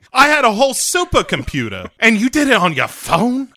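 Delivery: high, loud mock-indignant villain; Zorin. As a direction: act affronted in a put-on villain voice. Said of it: "Zorin is just like".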